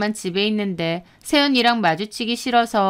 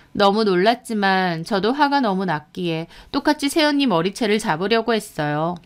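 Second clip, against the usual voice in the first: ordinary delivery, nothing marked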